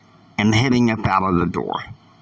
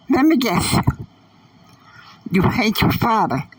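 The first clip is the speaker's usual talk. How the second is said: put-on much higher teen girl voice, knowing; 12 semitones higher than usual. A narrator's reading. Catalina guessing Annie hates her father, high girlish voice. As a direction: pitch up for a sassy young girl. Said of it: "Catalina said".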